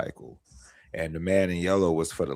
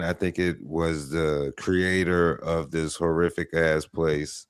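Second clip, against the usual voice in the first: ordinary delivery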